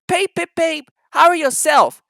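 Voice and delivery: high, loud cheeky peep; cheeky little engine. Acted. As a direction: answer back with a cheeky whistle. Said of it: "said Thomas".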